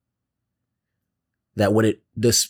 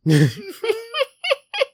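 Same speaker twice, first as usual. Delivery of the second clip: high cackle. Acted. Creature cackle.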